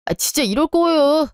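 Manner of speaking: sharp pitch jump, angry protest; husband raises his voice at his mother in frustration. Acted. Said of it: "왜 이렇게 소리 질러?".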